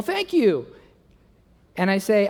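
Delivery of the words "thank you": high, lilting mock thanks; imitating wife. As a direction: play the pleased wife, pitched up. Voicing wife thanking husband.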